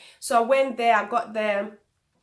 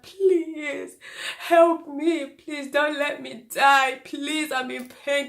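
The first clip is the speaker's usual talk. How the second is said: higher, slow, begging voice; desperate pleading; 4 semitones higher, much slower than usual. Retold or imitated. Mother in labour begging the midwife for help, slow desperate plea. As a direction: plead for your life, drawn out. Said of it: "I'm like".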